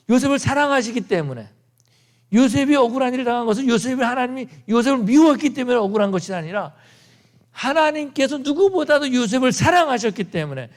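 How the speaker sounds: preacher proclaiming God's love; fervent preaching. Acted. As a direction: build to a passionate climax. Climactic preaching.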